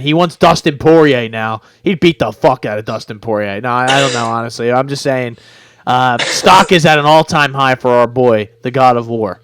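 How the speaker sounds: ordinary talk, nothing marked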